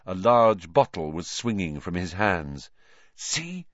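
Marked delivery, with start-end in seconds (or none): 3.2-3.7 soft, higher-pitched stage whisper; quiet, high whispered remark. A narrator's reading. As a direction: hush it urgently. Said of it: "Hermione whispered".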